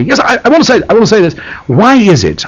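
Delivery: high-pitched, pointed questioning. Retold or imitated. Radio host challenging his guest Carl Sagan, pointed. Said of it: "quite pointedly".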